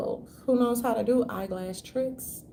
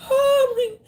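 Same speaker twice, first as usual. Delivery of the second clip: high, loud shocked gasp; hammed-up astonishment, much higher and louder; a theatrical gasp, pitched way up; mock gasp; 9 dB louder than usual.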